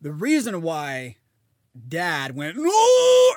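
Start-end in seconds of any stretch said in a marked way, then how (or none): 2.6-3.3 very high loud no; alarmed shout. Retold or imitated. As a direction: shriek it in alarm. Dad's reaction voiced.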